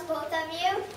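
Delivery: ordinary delivery, nothing marked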